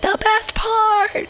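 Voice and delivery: high, drawn-out swoon; mock gushing. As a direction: gush it high and slowly, hamming it up. Gushing over early relationship weeks.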